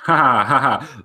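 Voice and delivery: real laugh, up high and loud; amused laugh. Real, not acted. Laughing at a friend's boast about eleven languages, amused. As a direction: laugh it off, teasing.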